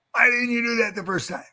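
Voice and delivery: retold coach question, pitched high; a stern coach asking why; high, pushed coach's challenge; coach Gable's demand. Wrestler retells coach Gable's question.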